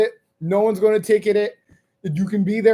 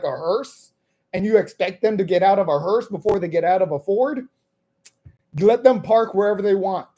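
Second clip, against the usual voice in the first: usual speaking voice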